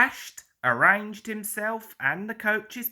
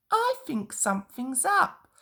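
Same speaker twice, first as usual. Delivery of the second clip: a slightly raised little engine voice; hint of Percy, a touch higher; 7 semitones higher than usual. A narrator's reading. Percy shares his hunch with Thomas and Toby, lightly raised voice. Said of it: "Percy went on".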